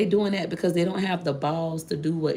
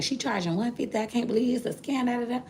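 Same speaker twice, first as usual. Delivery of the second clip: high, sneering hater voice; hammed-up hater; mocking hater; 8 semitones higher than usual. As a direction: mimic the jealous girl. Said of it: "the same girl that was like".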